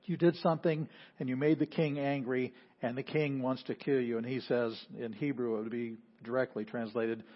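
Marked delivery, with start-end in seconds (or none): none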